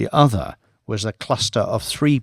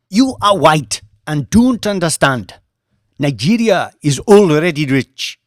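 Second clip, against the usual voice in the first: raised, higher voice, scornful; heated retort; 6 semitones higher, 4 dB louder than usual. A narrator's reading. Mamadou rebuking Banks about Nigeria, heated and scornful. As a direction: lecture him with scorn.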